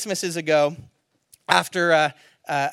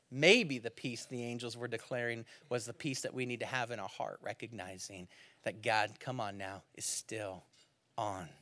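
hushed preaching; 7 semitones lower, 10 dB quieter than usual. Real, not acted. Preacher to congregation, hushed and intense. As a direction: pull them in quietly to the point.

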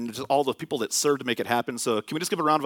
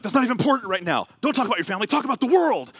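rising, insistent mock protest, louder at the end; heated retort; 9 semitones higher, 4 dB louder, faster than usual. Acquaintance brushing off the pastor's question, rising heated retort. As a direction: push back fast and climb higher.

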